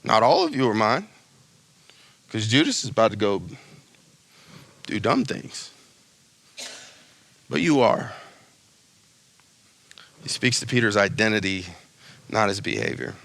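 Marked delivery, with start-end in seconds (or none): 0.0-8.1 tender reassurance. Retold or imitated. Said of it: "He just says".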